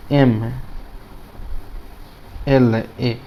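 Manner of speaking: एम, एल, ए letter by letter with long pauses; pronunciation demo. Shown as a demonstration. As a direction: spell out एम, एल, ए as separate sounds, pausing between. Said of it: "Hindi में उच्चारण क्या करते हैं". (एम, एल, ए का रुक-रुककर, अलग-अलग उच्चारण)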